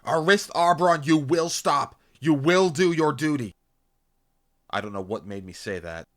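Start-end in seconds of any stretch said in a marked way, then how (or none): 0.0-3.5 high, loud, emphatic order; shouted command. A narrator's reading. Narrator commanding comrade to do his duty, forceful shout. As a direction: order him with full authority.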